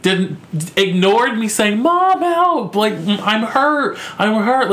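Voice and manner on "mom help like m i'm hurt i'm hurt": retold cry for help. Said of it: "screaming bloody murder".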